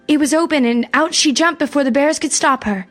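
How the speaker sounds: usual speaking voice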